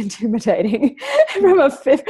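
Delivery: normal talking voice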